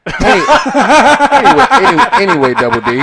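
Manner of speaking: loud laughing deflection. Real, not acted. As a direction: laugh it off, raising your voice. Laughing off the joke.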